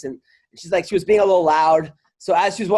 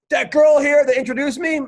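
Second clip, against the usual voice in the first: high, raised voice of a comic cracking up; ranting his lust into the mic; meltdown rant; 6 semitones higher than usual. Retold or imitated. Comic melting down on stage, high agitated rant. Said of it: "the guy has a meltdown".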